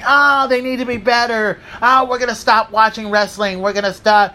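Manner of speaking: imitating a whining fan; whiny complainer. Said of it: "start complaining and start bitching".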